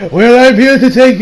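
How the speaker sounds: put-on abandoned stab at a Russian accent; attempted Russian accent. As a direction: try a Russian accent and give up. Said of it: "not gonna try to do a Russian accent".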